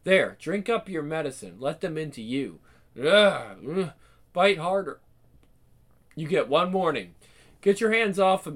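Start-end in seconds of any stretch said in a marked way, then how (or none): 3.0-3.9 gagging groans. Acted. Forced to drink, retching.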